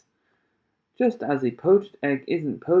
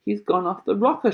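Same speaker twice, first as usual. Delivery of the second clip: higher, louder indignant yell; shouted; 6 semitones higher, 4 dB louder than usual. A narrator's reading. A father protesting about Wonka, shouting. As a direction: call out in alarm. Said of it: "shouted one of the fathers".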